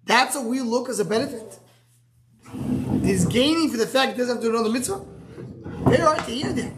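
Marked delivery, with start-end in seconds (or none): 5.9-6.7 laughing. Real, not acted. Teacher laughs at hard idea.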